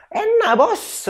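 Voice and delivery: a gleeful high yelp of delight; excited exclamation. Acted. Joking about big beds. (seruan riang dengan suara jauh lebih tinggi)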